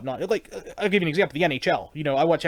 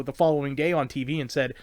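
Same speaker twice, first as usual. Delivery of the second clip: ordinary talk, nothing marked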